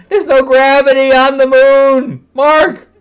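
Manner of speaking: high, loud comic rant; exasperated yell. Real, not acted. Scolding the writer about moon gravity, exasperated yell. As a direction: shout it at the writer in disbelief.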